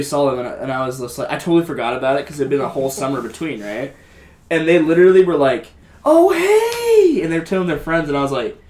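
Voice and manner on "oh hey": excited greeting. Retold or imitated. Old classmates greeting her excitedly.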